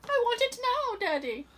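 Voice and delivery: high whiny brat pleading; whiny spoiled kid. Pretend. Spoiled child quote again.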